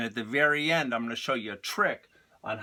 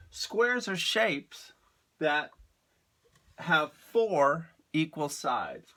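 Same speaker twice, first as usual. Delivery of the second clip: slow teacher voice; 3 semitones higher, much slower than usual. Shown as a demonstration. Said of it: "teaching a definition".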